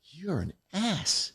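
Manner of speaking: slow, soft muttered insult at his desk; under his breath, slowly; muttered to himself. Retold or imitated. Alone at his desk after hanging up, slow soft mutter. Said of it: "I sat at my desk and go".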